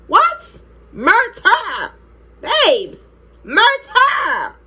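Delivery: hammed-up, very high outrage; sharp high exclamations, each falling away; ready for murder. Girl reliving how pissed she was, hammed-up fury. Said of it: "I was ready for murder".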